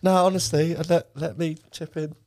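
high, loud, put-on insistence; hammed-up excuses in a squeaky voice; mock protest. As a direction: act out a phoney offer to chip in. Acts out feigned offer to pay.

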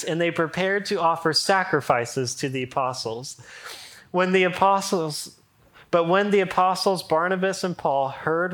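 loud, lively Bible reading; scripture reading. Acted. Pastor reading the Lystra story aloud to his church, loud and lively. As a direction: proclaim the passage to the room.

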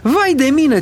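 high-pitched shriek of alarm; panicked cry. A narrator's reading. Lady finding that the boy is gone, panicked cry. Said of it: "țipă cucoana".

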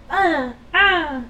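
high imitated raptor shriek; raptor screech. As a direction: squawk like a dinosaur. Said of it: "you hear".

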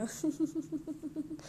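soft, high rippling giggle; long run of little giggles; nervous giggle. Real, not acted. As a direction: giggle softly through mock despair. Mock despair about growing up.

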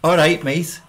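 punchy Scouse demonstration; Scouse accent. Shown as a demonstration. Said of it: "talk with a Scouse accent".

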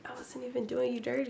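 unvoiced whisper. Real, not acted. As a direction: whisper it under your breath. Muttered aside.

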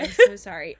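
loud, high, overdone apology; mock apology. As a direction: ham up the sorry. Mock apology after being corrected.

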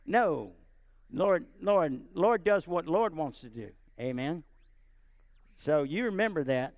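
ordinary talk, nothing marked

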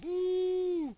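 high falsetto woo; high whoop. Real, not acted. Cheering his coffee break with a high woo.

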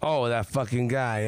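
ordinary talk, nothing marked

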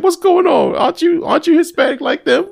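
very high, baffled retold questions; squeaky-high mock bewilderment; incredulous questioning. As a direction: question a friend in high-pitched disbelief. Retelling his baffled questioning of Walter.

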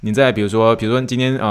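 ordinary talk

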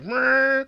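high whirring "mrrr"; imitated wheel noise. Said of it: "the little wheels".

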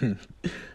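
a short, quiet chuckle; father giggling softly at his daughter; soft giggle. Acted. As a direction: giggle lightly at the squabble. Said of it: "Xavier giggles".

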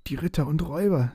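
frightened dragon gabbling "Ritter und Räuber" high and fast; panicked. Acted.

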